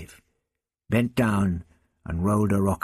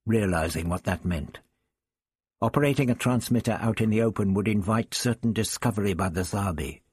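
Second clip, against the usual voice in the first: ordinary talk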